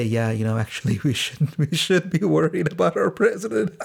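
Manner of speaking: attempted seriousness cracking into laughter; failed straight face. Dad feigning worry about the president to his daughter, breaking up laughing. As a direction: start earnest, then rise helplessly into a laugh. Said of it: "I try to seriously say".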